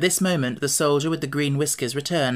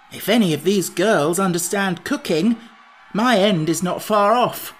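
raised, emphatic worry; anxious; 5 semitones higher, 5 dB louder than usual. A narrator's reading. Pumpkinhead fears the girls will cook him, anxiously. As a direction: fret aloud about your coming doom. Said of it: "said the Pumpkinhead anxiously".

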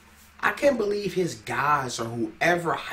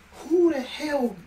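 much higher, slow, laughing disbelief; incredulous; 13 semitones higher than usual. Real, not acted. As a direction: squeak out how can this be, half laughing. Mocking disbelief at the rapper.

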